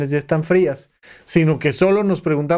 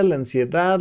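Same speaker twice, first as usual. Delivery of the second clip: ordinary delivery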